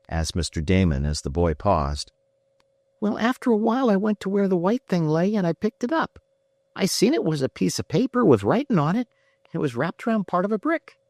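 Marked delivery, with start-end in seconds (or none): 3.0-11.0 put-on high, simple barefoot farm boy telling it; a shy young lad recounting what he saw; country boy. A narrator's reading. Farm boy tells Ned how he found the note.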